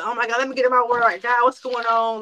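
urgent, flustered appeal to God; retold scrambling. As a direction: scramble for answers in mild panic. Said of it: "I started scrambling".

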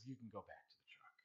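soft whispered dismissal; father quietly sending his breathless son back, whispered; imitating dad's whisper. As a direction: whisper it like a disappointed dad. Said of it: "he says".

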